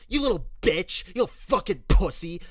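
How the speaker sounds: loud, high sneering abuse; mocking bully yelling insults; vitriolic insult. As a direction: spit it out at the bullied kid. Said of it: "they spoke to me with such vitriol and hatred".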